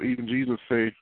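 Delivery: normal talking voice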